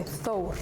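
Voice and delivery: Thor with th and a long oh; Icelandic pronunciation. Shown as a demonstration. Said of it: "In Iceland".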